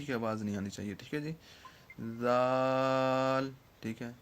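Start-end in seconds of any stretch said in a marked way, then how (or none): 2.2-3.5 ذال held long for five beats, no whistle on the ذ; drawn-out letter. Shown as a demonstration. Teacher showing students a long ذال without whistling. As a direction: stretch ذال for five counts, keep the hiss out. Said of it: "سیٹی کی آواز آپ کی بالکل نہیں آنی چاہیے".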